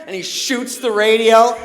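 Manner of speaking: ordinary talk, nothing marked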